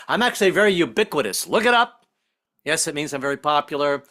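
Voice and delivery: ordinary delivery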